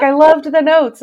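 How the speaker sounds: high, loud retold gushing; imitated enthusiasm. Designer praising the editor's notes, imitated delight. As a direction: act out someone thrilled, pitched well up.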